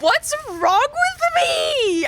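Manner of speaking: very high, slow squeaky wail; mock wail. Performing inconsolable despair for friends, very high mock wail. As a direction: stretch it out in a hammed-up distraught squeak. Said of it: "and then I was like".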